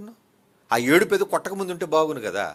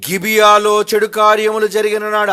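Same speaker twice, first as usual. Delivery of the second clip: loud scripture reading; 6 semitones higher, 12 dB louder than usual. Acted.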